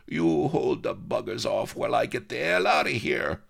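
put-on wistful old yokel saying goodbye; dying old rustic. Shafto bolts.

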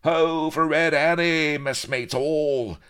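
high, loud pirate hail, drawn out at the end; rousing cry. A narrator's reading. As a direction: holler a greeting across the crowd. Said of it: "cries of".

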